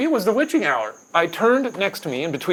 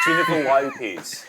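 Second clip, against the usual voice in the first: mock Aussie vowels; exaggerated Australian pronunciation; Australian accent; 4 semitones lower than usual. Shown as a demonstration. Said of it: "you gotta do the accent now".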